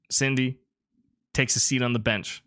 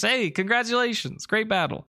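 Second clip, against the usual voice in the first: higher, friendly trainer voice; cheerful girl voice; 11 semitones higher than usual. Acted. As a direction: congratulate brightly.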